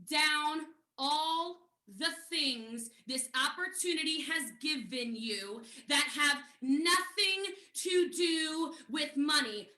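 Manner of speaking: slow, measured instruction; slow dictation. Retold or imitated. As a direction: spell out the assignment word by word, slowly. Dictates the sponsor's written assignment.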